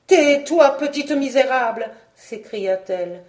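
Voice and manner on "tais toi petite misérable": loud, high angry command; furious outburst. A narrator's reading. Frog queen silences laughing girl, furious outburst. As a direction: lash out to silence her. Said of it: "entra dans une inexprimable colère".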